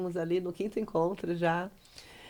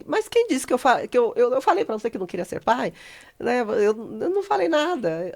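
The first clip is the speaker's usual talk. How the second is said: acted protest; 5 semitones higher, 6 dB louder than usual. Acted partner's protest in retold quarrel.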